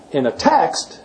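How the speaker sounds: "text" lifted above the other words; stress on "text". Shown as a demonstration. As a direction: lean hard on the final "text". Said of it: "see, I just emphasized text".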